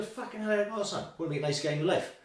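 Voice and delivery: high-pitched re-enacted astonishment; retold startled exclamation. Man telling of a fighter walking straight at him, startled. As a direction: start high in mock alarm, then ease down. Retells reaction to fighter approaching.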